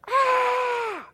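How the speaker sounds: high, loud frantic scream. Pretend. Preacher acts being crowded by guilt, sudden loud scream. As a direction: let out a sudden cornered scream. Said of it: "You're screaming".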